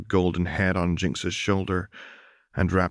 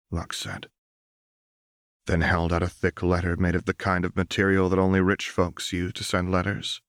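plain reading voice

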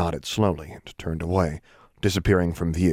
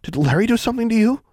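high, fast, louder alarm; high quick alarmed girl; 4 dB louder, faster than usual. A narrator's reading. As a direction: blurt it in sudden worry. Anna alarmed.

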